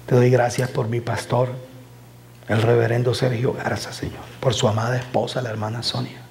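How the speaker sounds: oración lenta y baja, recogida; prayer. Acted. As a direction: ora con reverencia y calma. Oración final. (oración pastoral lenta y reverente)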